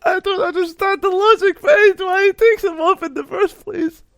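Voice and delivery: very high, loud squeal through laughter; real laughing fit, voice shooting up; helpless laughter. Real, not acted. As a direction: barely get it out for laughing. Cracking up over the sunglasses fumble.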